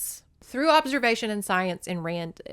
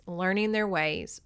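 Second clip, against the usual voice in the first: ordinary talk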